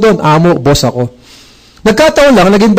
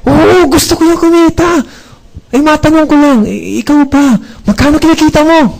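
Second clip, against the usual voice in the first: higher, hammed-up eagerness on "Magkano kinikita mo?"; mock eager reply; 9 semitones higher than usual. Boss mockingly asking the recruiter his income, hammed-up eager. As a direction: play along with exaggerated interest. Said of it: "gigil na gigil din ako sa pagsagot".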